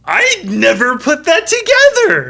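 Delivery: high, loud, sudden discovery; the penny drops all at once; surprised realization. Real, not acted. Friend realizing the scar's meaning, surprised and loud.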